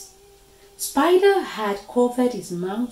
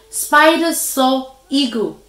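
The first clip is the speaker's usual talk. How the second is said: usual speaking voice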